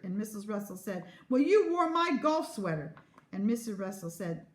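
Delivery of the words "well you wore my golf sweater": high, loud comeback; petulant squabbling; accusing retort. A narrator's reading. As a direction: fire it back indignantly. Parents quarrelling over clothes.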